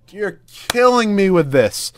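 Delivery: slow, loud, high complaint; exasperated wail. Real, not acted. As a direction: draw it out in anguish. Complaining about the game.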